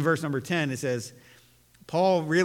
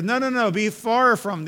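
higher, louder, insistent no; protesting Peter; 6 semitones higher, 5 dB louder than usual. Acted. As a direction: Peter refusing to accept it.

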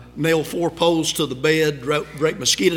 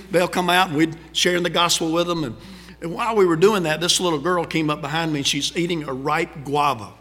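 ordinary delivery

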